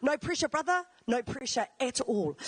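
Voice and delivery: usual speaking voice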